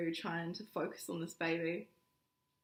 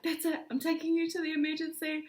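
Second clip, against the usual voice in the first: husband's urgent decision; 9 semitones higher, 6 dB louder than usual. Retold or imitated.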